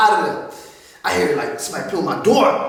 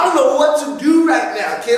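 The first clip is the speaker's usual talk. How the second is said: normal talking voice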